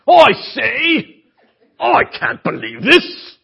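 high, loud, slow hammed-up British toff; posh British accent. Preacher mocking the arrogant Pharisee, hammed-up British accent. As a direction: sneer it like a pompous Englishman. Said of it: "he might have been part British".

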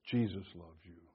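low and hushed; soft recalled message. Retold or imitated. Preacher recalling what the jail visitors told him, softly. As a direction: let each short phrase land quietly.

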